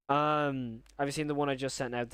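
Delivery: usual speaking voice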